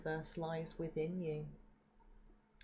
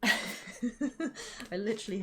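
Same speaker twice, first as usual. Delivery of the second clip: laughing; 6 semitones higher, 6 dB louder, slower than usual. Real, not acted. Laughing at the sneeze.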